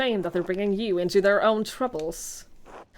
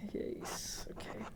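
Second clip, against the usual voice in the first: whisper; 16 dB quieter, much slower than usual. Real, not acted. Reacting softly to game text.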